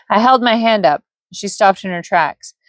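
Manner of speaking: ordinary delivery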